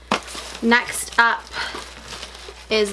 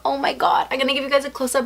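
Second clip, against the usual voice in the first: usual speaking voice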